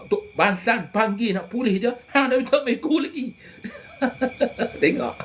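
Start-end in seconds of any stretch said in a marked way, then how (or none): 2.1-5.2 1 laughing retelling. Real, not acted. Laughing, retelling the old man.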